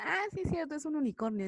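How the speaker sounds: high-pitched acted "aha" moment; mock realization. Naive viewer seeing a unicorn painting, mock realization. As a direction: play a naive viewer suddenly convinced, pitch jumping up then settling.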